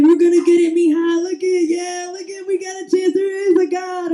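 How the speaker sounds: high-pitched, fired-up game-day hollering; high, hopeful fan cheer; excited dad cheering. Retold or imitated. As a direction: imitate a tipsy dad rooting for his team.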